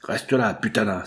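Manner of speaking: usual speaking voice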